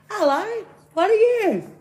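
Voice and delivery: loud-mouthed, very high frog voice; wide-mouthed frog. Acted. Frog greeting the bear in a joke, very high voice. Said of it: "he goes".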